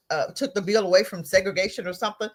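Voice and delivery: usual speaking voice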